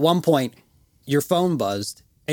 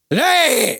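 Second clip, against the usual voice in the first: loud, high shout of recognition; high, loud drunken hey; 11 semitones higher, 6 dB louder than usual. Retold or imitated. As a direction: yell it like a wasted friend. Drunk Stratton shouting greeting.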